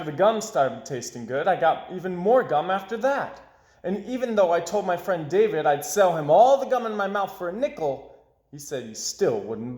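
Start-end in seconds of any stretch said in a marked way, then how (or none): none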